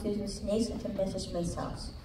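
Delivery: usual speaking voice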